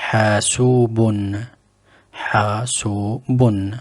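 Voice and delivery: mibratun, drawn out slowly and clearly; slow demo word. Shown as a demonstration. Teacher models a new Arabic word slowly. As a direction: sound out mibratun at a slow pace. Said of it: "Le troisième".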